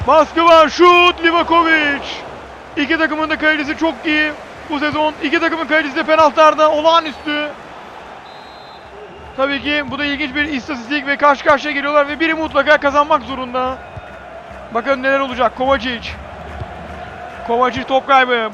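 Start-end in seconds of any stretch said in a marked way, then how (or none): none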